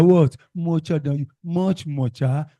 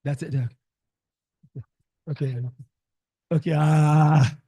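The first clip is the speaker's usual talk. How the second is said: ordinary talk, nothing marked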